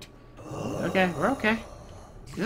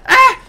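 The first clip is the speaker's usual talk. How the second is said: startled scream; 16 dB louder than usual. Real, not acted. Startled by zombie.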